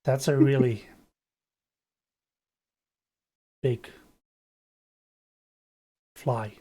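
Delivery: spaced-out stoner, long gaps between words. Acted. Stoner tripping too hard at the door, dazed and halting. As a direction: let each word drift out after a long pause. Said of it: "like, a stoner guy at the door".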